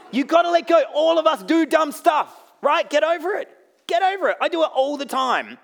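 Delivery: high, loud, punchy exhortation; full-force preaching. Real, not acted. Preacher telling the church to let go, full-force shout.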